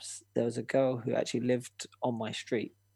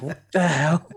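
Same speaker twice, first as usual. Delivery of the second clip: sudden loud "what the hell"; shocked outburst; 4 semitones higher, 8 dB louder than usual. Retold or imitated. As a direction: blurt it out, alarmed and loud. Shock at creepy message.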